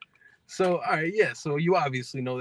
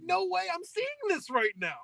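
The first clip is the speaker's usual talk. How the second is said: disbelief; 4 dB quieter than usual. Retold or imitated. Retold disbelief at sight.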